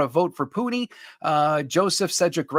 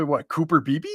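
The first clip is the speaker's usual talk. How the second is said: put-on a quick Irish accent on "Cooper Beebe". Host answering a viewer's joke, tries an Irish accent. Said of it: "you could have said that with an Irish accent".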